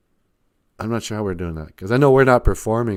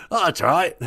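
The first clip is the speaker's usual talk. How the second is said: higher, far-off regal English lady voice; Queen of England voice; 7 semitones higher than usual. Acted. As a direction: speak as a grand queenly deity. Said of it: "you kinda sound like the Queen of England".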